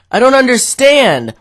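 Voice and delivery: loud, high-pitched mock disbelief; retold bewilderment. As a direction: cry it out, baffled. Retold bewildered reaction to sleep talk.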